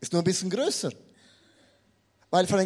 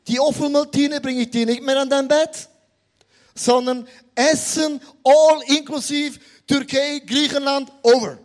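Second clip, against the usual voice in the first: louder, firm mother laying down the law; stern mother; 4 semitones higher, 7 dB louder than usual. Acted. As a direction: announce it like a strict mom. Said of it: "die Mutter sagt". (lauter, strenger Muttertonfall)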